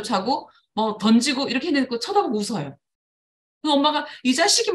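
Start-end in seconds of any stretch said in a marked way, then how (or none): 4.2-4.7 high, loud exasperated snap; a mother losing her temper; mock angry. Mother snapping at her grinning child, mock anger. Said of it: "막 이러는 거예요".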